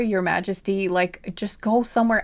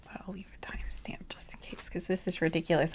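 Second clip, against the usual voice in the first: hushed, sheepish whisper; whispered aside; 11 dB quieter than usual. Real, not acted. Reader to viewers, whispering sheepishly. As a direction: whisper it like an embarrassed confession.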